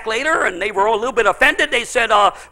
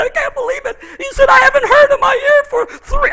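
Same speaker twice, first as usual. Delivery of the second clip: much higher, crying with disbelief; tearful amazement; 13 semitones higher, 4 dB louder than usual. Retold or imitated. Healed man hugging the pastor, high crying disbelief. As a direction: squeeze it out high through tears.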